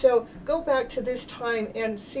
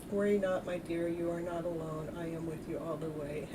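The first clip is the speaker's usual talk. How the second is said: much softer, lower, tender reassurance; soothing reading; 5 semitones lower, 10 dB quieter than usual. Acted. Healer reading a viewer's comment in a hushed comforting voice. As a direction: read it like a soft comforting spirit. Reading a chat message aloud.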